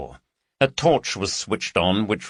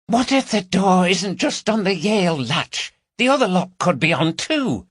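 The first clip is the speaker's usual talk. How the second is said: Carol, anxious; 11 semitones higher, 3 dB louder than usual. Acted. Carol's worried whisper at door.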